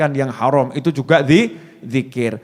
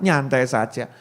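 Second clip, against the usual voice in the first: ordinary delivery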